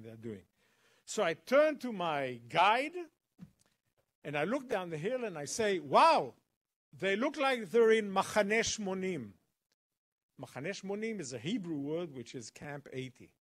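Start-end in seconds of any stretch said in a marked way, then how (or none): 5.8-9.3 a loud, amazed wow. Retold or imitated. To the guide at the hill, a loud amazed wow. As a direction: exclaim it, impressed. Calling guide's attention to soldiers.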